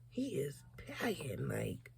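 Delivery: hushed aside; whisper. Real, not acted. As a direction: whisper it like a secret. Aside about his body.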